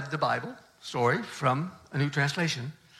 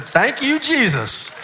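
louder, higher burst of thanks; relieved exclamation; 8 semitones higher, 7 dB louder, slower than usual. Retold or imitated. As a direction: throw it up to heaven in relief. Relieved at surprising compliment.

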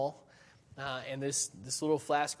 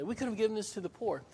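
higher, fast mock indignation; imitating the disciples' huffy objection; indignant; 6 semitones higher, faster than usual. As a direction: rattle it off like a self-righteous complaint. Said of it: "said indignantly".

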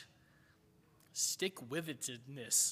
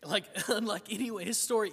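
laughing restart, a bit higher; 4 semitones higher, 5 dB louder than usual. Real, not acted. Laughs after swallowing bug.

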